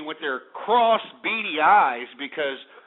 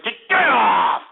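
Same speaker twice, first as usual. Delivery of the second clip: angry shout; 5 semitones higher, 6 dB louder, slower than usual. Real, not acted. Radio host shouting a prank caller off the line, furious. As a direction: yell him off the line, loud and high.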